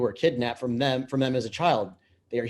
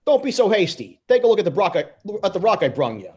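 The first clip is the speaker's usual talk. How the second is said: loud, higher brash wiseguy pitch; cocky bluster; 8 semitones higher, 7 dB louder, faster than usual. Acted. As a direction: brag it big and loud. Mario bluffs Big Eddie.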